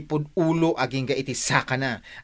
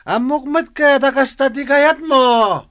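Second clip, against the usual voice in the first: raised, higher angry voice hurled at the spouse; angry shout; 11 semitones higher, 6 dB louder, slower than usual. Acted. Spouse confronting a selfish partner, angry shout. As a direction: snap it out in open anger. Heated family quarrel.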